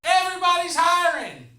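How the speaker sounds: high, drawn-out shout; yelling out the window. Retold or imitated. Driver to a panhandler outside the car, yelling. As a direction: holler it with long, stretched words. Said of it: "I yell out the window".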